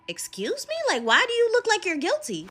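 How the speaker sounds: high, incredulous imitated challenge; taken aback. Rose seeing Leon look guilty, incredulous. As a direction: demand it in rising disbelief. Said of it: "Her face is like".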